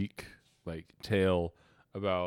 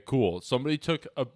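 usual speaking voice

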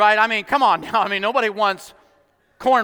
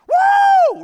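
high, loud celebratory woo; excited whoop; 10 dB louder than usual. Acted. Crowd hears meat is coming, excited whoop. People cheering news of meat.

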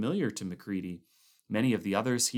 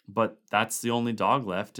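ordinary delivery, nothing marked

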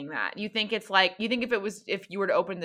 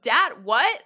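put-on questioning kid; 5 semitones higher, 5 dB louder than usual. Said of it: "they're like".

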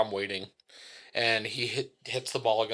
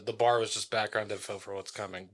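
usual speaking voice

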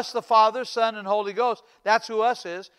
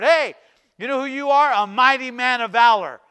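lightly acted, raised heralding voice; angel's greeting; 3 semitones higher, 7 dB louder than usual. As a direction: hail him with a bold word. Angel hails Gideon, retold.

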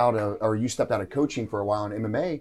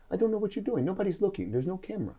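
puzzled friend; 8 semitones higher, 7 dB quieter than usual. Retold or imitated. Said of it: "and he goes".

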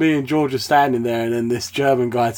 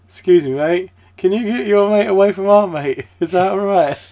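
higher-pitched mock-polite appeal; acted polite request; 7 semitones higher, 4 dB louder than usual. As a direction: ask it carefully, lifted and placating. [name] politely asks other German.

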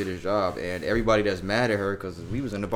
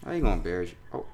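ordinary talk

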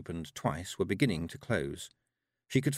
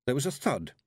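ordinary delivery, nothing marked

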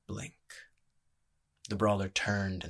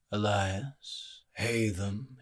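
intoned; much slower than usual. Acted. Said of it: "he intoned".

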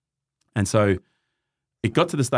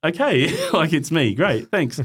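awkward reply; 7 semitones higher, slower than usual. Retold or imitated. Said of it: "And I went".

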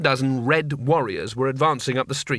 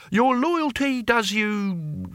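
high, slow pompous praise; grandly drawn-out, pitched up; playing emperor; 8 semitones higher, much slower than usual. A narrator's reading. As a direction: put on a stately imperial manner. Cohen acting like an emperor.

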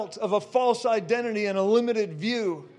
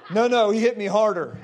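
ordinary talk

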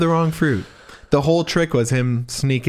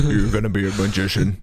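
low creepy magician-mother; Blaine's mom; 4 semitones lower than usual. Acted. Mom to her son, in the low magician voice. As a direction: lay down the law in a low drone. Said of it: "then they talk like that too".

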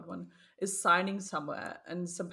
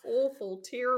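put-on New Zealand accent; 5 semitones higher than usual. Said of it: "terrible in your New Zealand accent".

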